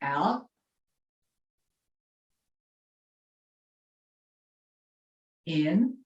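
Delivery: calmly cue each breath; guided breathing cues. Acted. Leads breathing exercise.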